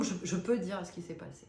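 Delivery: ordinary delivery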